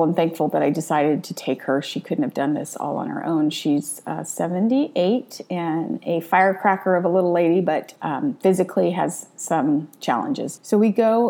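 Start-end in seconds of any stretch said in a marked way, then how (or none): none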